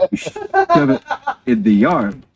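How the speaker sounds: breaking into a high laugh mid-phrase; giggling through the words. Real, not acted. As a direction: laugh it out, pitch jumping up. Laughing while telling the joke.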